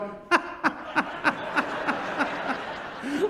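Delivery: breathy rising laugh burst; burst of laughter. Retold or imitated. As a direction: imitate her breaking into laughter. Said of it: "she breaks into laughter going".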